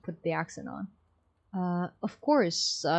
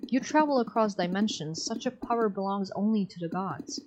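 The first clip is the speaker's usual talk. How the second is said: ordinary talk, nothing marked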